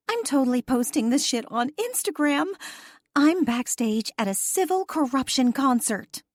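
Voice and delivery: high, loud and thrilled; excited woman. A narrator's reading. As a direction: gush over being backstage. Gina excited backstage.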